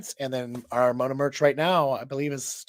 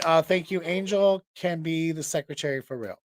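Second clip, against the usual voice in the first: ordinary delivery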